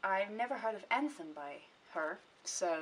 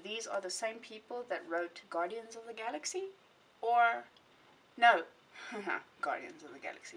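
usual speaking voice

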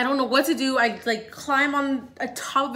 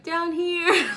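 high sheepish call breaking into a laugh; embarrassed call; 6 semitones higher than usual. Retold or imitated. Calling up to the firemen, she laughs sheepishly. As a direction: call out then crack up.

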